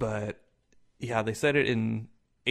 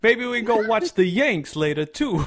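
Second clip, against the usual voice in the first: higher, louder New York guy, laughing; New York accent; 9 semitones higher, 6 dB louder than usual. Pretend. Friend answers the Boston clown in a New York accent. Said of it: "all New York and shit".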